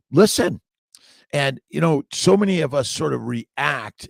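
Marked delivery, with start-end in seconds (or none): 0.1-0.6 sharp, loud, higher "Listen."; loud call to the chat; attention call. Real, not acted. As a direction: grab their attention, loud. Calling audience to attention.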